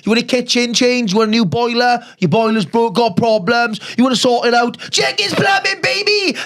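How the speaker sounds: high, shouty radio-ad pitch; hammed-up ad read. Podcast host selling a plumbing sponsor, hammed-up ad shout. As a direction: belt out the sponsor's name, stretching it out.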